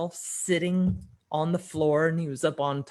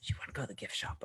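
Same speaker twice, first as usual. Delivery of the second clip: whispered tease; 3 semitones lower, 12 dB quieter, much faster than usual. Retold or imitated. Mother teasing daughter about gift shop.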